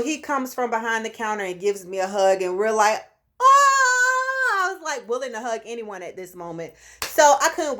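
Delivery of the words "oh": long, high mock-sobbing "oh"; emotional wail. Friends hugged by the gym clerk, overwhelmed wail. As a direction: re-enact a gushing cry during a hug. Overwhelmed group hug at counter.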